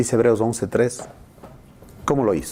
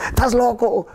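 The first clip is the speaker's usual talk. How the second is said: incredulous scoff; 12 semitones higher than usual. Retold or imitated. Skeptic again.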